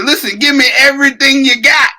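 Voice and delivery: high, rushed, demanding quote; hammed-up cheater begging for the whole supply; mock eager demand. Mocking the boxer ordering up every drug, mock eager. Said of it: "He said".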